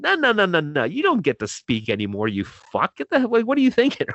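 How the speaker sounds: retold scolding, pitch jumping high; acted-out dismissive interruption; cutting him off. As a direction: shut him down with a string of no's. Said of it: "I just kept cutting him off".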